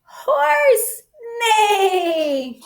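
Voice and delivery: thrilled high call; excited cry. Acted. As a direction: shout it out with glee for the kids. Announcing the sound button.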